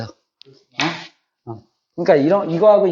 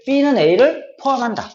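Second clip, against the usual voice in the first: slow, raised model reading; slow reading aloud; 5 semitones higher, slower than usual. Shown as a demonstration. Teacher to students, modelling how to read it, slow and raised. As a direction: say it slowly so they can copy it. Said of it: "이렇게 읽을 수 있지만".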